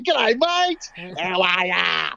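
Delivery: lightly hammed-up Australian accent; thick Australian accent. Aussie abroad greeting another Aussie, light Australian accent. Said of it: "putting it on really thick".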